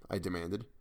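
plain reading voice

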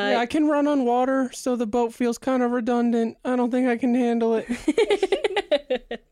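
high character voice. Acted.

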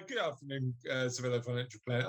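low, quick posh phone greeting. Pretend. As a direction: put on a posh business voice. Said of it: "I'm putting my posh voice on".

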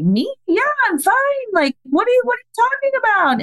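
high-pitched, startled disbelief; retold shock, voice shooting way up into questions. Mother to daycare owner asking if she's okay, shocked. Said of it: "I was kind of shocked".

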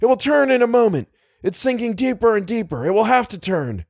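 high-pitched rallying cries; urgent exhortation. A narrator's reading. Leader drives the men after the monster, exhorting. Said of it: "he cried".

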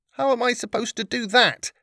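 put-on high, disbelieving young girl; exasperated girl. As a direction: protest in disbelief. Lillian protests, spits water.

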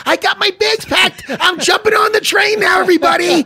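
high, loud excited mock shouting; Jimmy Norton. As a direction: yell it like an overexcited little fan. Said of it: "little Jimmy Norton's going".

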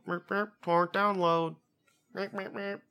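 high-pitched cartoon computer meeping; mock download beeps. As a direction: do a silly machine voice, pitched well up. Jokey imitation of downloading noises.

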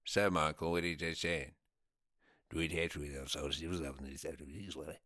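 low, soft, garbled Irish mumble; mock unintelligible Irish accent. Imitating an unsubtitled jail scene, garbled low Irish accent. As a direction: mumble an impenetrable Irish dialogue. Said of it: "do my impression of that scene".

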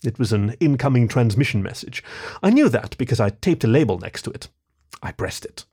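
plain reading voice

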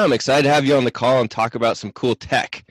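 ordinary talk, nothing marked

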